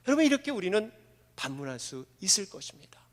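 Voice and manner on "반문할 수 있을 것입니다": hushed whisper. Real, not acted. Preacher drops to a whisper telling the congregation, whispered. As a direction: drop to a whisper.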